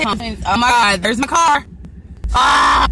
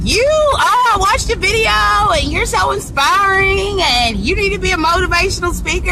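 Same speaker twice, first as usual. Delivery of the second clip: imitating admirers; 8 semitones higher than usual. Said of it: "They all say".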